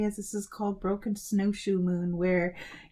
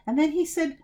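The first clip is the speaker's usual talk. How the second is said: ordinary delivery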